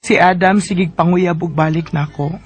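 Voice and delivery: low, even storyteller voice wrapping up the tale; radio drama host reading the listener's closing words; closing narration. A narrator's reading.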